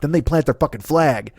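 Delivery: ordinary delivery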